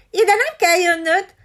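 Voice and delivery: high, loud bragging squawk; boasting parrot. A narrator's reading. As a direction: brag like you own the tree. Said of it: "kérkedett".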